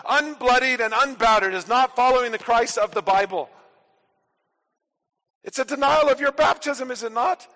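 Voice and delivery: loud, driving pulpit voice, each clause hammered; full-force preaching. Acted. Preacher rousing his congregation, loud driving preaching. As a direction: preach it out to the whole church.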